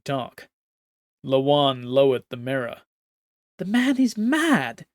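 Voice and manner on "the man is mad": outraged empress. Acted.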